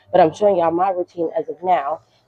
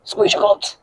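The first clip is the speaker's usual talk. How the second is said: squeaky high. Shown as a demonstration. Coach ends the glute reminder to viewers, high playful squeak. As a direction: squeeze your glutes jumped way up high in a squeak. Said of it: "guys, please".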